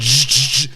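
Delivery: three short buzzing noises; imitated short-circuit buzz. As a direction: buzz like someone glitching out. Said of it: "he went".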